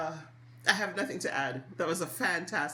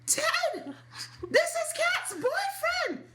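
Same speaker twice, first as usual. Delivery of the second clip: much higher, louder, slow called-out announcement; yelling across room; 3 dB louder, much slower than usual. Retold or imitated. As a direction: shout it across a crowded room. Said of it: "As he yells across the room".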